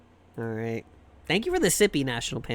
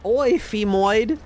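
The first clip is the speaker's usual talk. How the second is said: mock British; 5 semitones higher than usual. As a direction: play a crude British lad chatting someone up. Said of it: "what do they call women in, in England".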